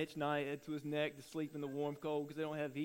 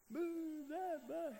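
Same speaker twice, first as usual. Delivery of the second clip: high, very slow drawn-out chant; preacher acting the nervous family's famous line; family shouting the catchphrase; 11 semitones higher, 6 dB quieter, much slower than usual. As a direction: stretch it like the famous call to move the bus. Family calls the show phrase.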